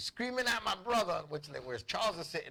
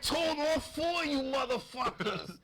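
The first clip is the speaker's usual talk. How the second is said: put-on drunk father; 7 semitones higher than usual. Drunk father scolding his kids, hammed-up slow yell. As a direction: imitate a drunk dad bellowing at the kids. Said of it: "it's because he was drunk".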